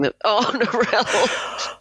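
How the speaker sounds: slow, high laughing through emotion; teary laugh. Real, not acted. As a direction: laugh to recover.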